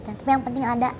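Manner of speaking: ordinary delivery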